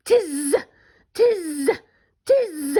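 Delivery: buzzy monkey mimicry; three separate playful buzzing calls; teasing monkeys. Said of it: "monkeys only stamped both their feet".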